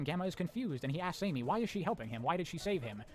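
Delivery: ordinary delivery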